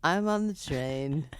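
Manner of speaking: slow low dopey troll voice. Pretend. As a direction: drone it like a dim troll. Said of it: "I'm gonna do the troll voice".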